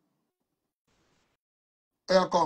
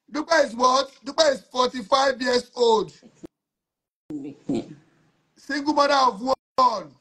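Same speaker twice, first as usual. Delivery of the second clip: higher, slow hype announcer read; live show announcer; 5 semitones higher, slower than usual. Acted. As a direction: present her like a host selling a guest. Announces caller to audience.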